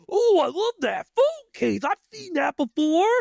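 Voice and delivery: high, excited cashier voice; gushing cashier. Retold or imitated. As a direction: gush over the phone case.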